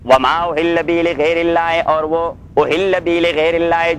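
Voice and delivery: slow, drawn-out chanted recitation; slow Quran recitation. Shown as a demonstration. Teacher reciting the verse to his students, slow chanted recitation. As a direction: intone the verse slowly, stretching each word.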